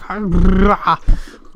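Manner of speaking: loud, high disbelieving laugh; burst of laughter. Real, not acted. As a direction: laugh out loud at the creepy clip. Reacting to freaky video.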